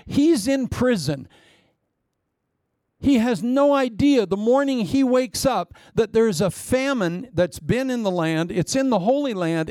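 ordinary talk, nothing marked

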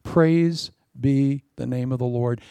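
ordinary talk

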